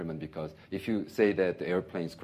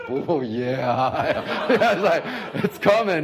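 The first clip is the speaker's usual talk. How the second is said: laughing imitation; 7 semitones higher, 7 dB louder, much slower than usual. Imitating pastor's serene smile.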